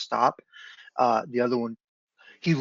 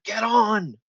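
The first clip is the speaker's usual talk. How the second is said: high, urgent call to mount up; yelling; 9 semitones higher, 5 dB louder than usual. Acted. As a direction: yell it to fleeing companions.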